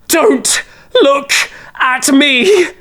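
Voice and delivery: high, desperate plea; voiced woman pleading desperately. A narrator's reading. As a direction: beg them not to look. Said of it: "the desperation in it".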